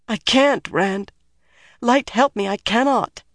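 high, distressed plea; high-voiced and torn; anguished refusal. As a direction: refuse in despair, pitched high. Anguished refusal to kill Rand.

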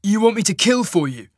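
loud, high outraged question; demanding. Acted. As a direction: hurl it back at him. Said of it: "Cole demanded".

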